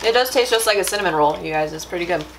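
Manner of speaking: eating a snack while speaking; talking while chewing. Real, not acted. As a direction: mouth partly full. Seen 1: Bit cereal at -4, holds piece, mouth working while talking.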